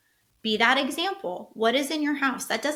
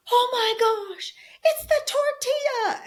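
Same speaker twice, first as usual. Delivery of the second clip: put-on much higher, slow and amazed, like a kid brother; excited kid brother; 13 semitones higher, 4 dB louder, slower than usual. Kid brother in the car, amazed at the tortilla, high excited squeal. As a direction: squeal it out as the little brother who just figured it out. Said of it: "his brother looks at him and is like".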